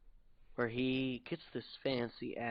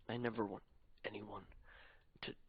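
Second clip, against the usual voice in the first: soft whisper; 3 semitones lower, 7 dB quieter than usual. Acted. Quiet confession after the ordeal.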